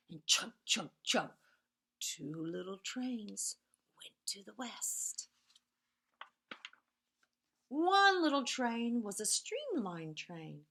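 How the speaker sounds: lively storybook reading with whispered refrains; reading to children. A narrator's reading. Teacher reads Two Little Trains to the class, sing-song storytelling. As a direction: read it like a bedtime picture book, rhythmic and playful.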